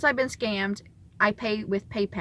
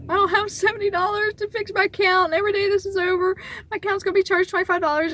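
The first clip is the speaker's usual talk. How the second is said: high, louder, rushed panic; panicked; 9 semitones higher, 4 dB louder than usual. Retold or imitated. Stuck without seventy dollars, a high, louder, rushed panic. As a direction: let the worry spill over fast. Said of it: "I'm just a basket case at this point".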